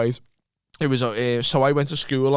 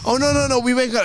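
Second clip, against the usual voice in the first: higher, flustered retold pleading; mock panic; 8 semitones higher, faster than usual. Boy pleading with an angry dad, mock panicked. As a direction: imitate backtracking in a panic. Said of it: "immediately just collapse into panic".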